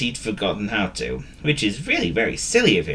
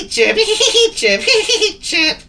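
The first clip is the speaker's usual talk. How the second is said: high, loud bird snickers between chirps; snickering and chirping; 7 dB louder, slower than usual. A narrator's reading. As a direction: alternate squeaky sniggers and chirps. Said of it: "snickering and chirping at the same time".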